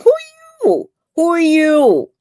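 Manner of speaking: very high, incredulous who are you; mocking disbelief. Real, not acted. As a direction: sneer it up high.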